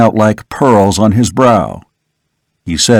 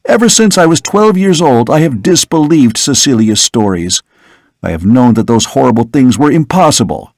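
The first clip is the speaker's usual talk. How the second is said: put-on higher, insistent young man; earnest young son; 4 semitones higher than usual. Son insisting to his father he fears nothing, higher and earnest. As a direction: press the point to your father.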